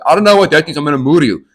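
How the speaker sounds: imitating an angry threat; mock threat. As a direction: menace him like a hothead. Boyfriend threatens him.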